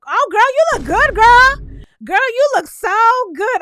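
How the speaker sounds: high, loud, gushing praise; gushing girl voice. Retold or imitated. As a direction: imitate Huda gushing over the new girl. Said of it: "And here goes Huda".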